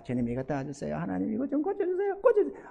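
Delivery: mock a lukewarm prayer; the preacher mocking his own lukewarm prayer, soft and casual; half-hearted prayer. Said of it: "깔짝깔짝".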